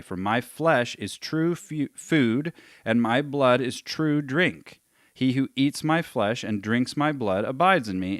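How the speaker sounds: forceful read-aloud with key words punched; emphatic scripture reading. Acted. Teacher reading Jesus' teaching on flesh and blood, emphatic scripture reading. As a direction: read it out loudly, driving each point home.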